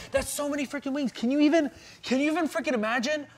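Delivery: high, fast and giddy; excited squirrel voice. Acted. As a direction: squeal about the free wings. Squirrel hypes the free wings.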